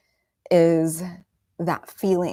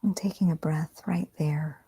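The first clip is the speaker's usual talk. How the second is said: soft, whispery meditation guide; guided meditation; 7 dB quieter than usual. Acted. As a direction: ease them gently back into the room.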